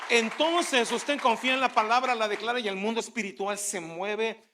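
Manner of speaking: loud, driving sermon cadence; preaching at full force. Acted. Preacher rallies the congregation about the spiritual world, loud. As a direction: rouse the congregation.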